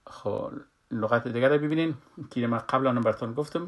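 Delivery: normal talking voice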